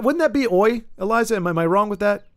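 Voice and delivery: ordinary delivery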